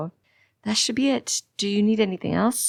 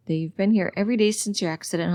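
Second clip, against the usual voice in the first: usual speaking voice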